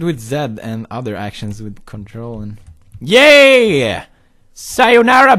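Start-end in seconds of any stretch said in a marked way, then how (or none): 3.0-5.4 gleeful shout. Real, not acted. Launches triumphantly.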